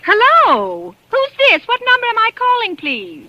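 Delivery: frightened caller asks who is on the line; alarmed questions. Acted. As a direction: voice climbs in sudden alarm. Woman alarmed by strange voices.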